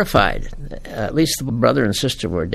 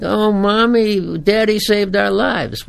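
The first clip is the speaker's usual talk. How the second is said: put-on higher, slow gushing little girl; excited child; 8 semitones higher, slower than usual. Child telling mommy on the pier, higher and slow, gushing. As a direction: gush like a relieved kid to mom.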